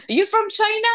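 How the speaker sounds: high-pitched imitated pupil question; curious questioning. (taas nga tingog, nangutana)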